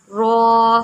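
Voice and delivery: رو said full and heavy. Shown as a demonstration. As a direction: make رو thick and rounded. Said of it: "رو کو بھی موٹا کر کے پڑھیں گے".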